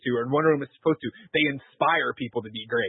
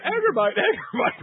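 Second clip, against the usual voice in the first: high-pitched imitation of a father calling; mock calling out; 11 semitones higher than usual. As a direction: call the name as if running to him. Father running, calling son's name.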